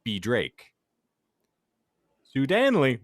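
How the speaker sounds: normal talking voice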